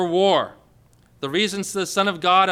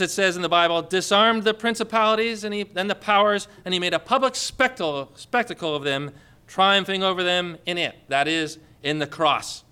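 ordinary talk

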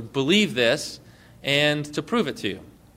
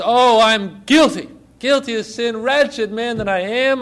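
anguished confession; 6 semitones higher, 4 dB louder than usual. Acted. Prisoner confesses to the king, hammed-up anguished confession.

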